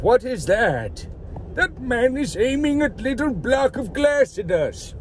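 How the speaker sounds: slow, puzzled mock voice of old folks at the gym; confused old gym-goers. Old gym-goers eyeing the camera, slow puzzled mock voice. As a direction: drawl it out like a baffled old-timer. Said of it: "They're like".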